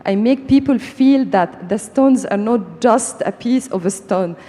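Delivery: ordinary delivery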